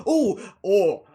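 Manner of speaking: sudden loud oh oh, caught off guard; startled yelp at a surprise noise; startled outburst. Real, not acted. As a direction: jump at an interruption. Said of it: "I just got interrupted by".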